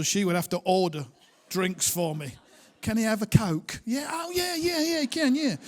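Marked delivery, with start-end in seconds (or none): 2.8-3.8 mock posh London accent, a bit louder. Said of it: "my wife, thankfully, was born in Brixton". 3.8-5.6 much higher, eager yeah-yeah-yeah reply; eager barista. Retold or imitated. London barista answers the wife.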